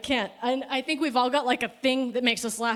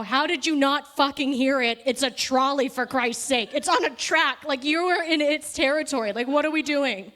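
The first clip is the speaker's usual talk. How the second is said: loud, higher ranting outburst; imagined yelling at the kid about the trolley; exasperated rant; 5 semitones higher, 6 dB louder than usual. Retold or imitated. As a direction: let it rip in disbelief. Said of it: "bite my tongue so hard to not go".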